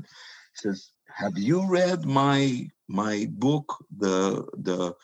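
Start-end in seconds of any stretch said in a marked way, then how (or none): 1.1-4.9 slow, halting, stumbling over the words; very slow old man. Retold or imitated. Brezhnev, nearly eighty, slowly asking a visitor about his book, halting. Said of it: "and this is how he talked, very slow".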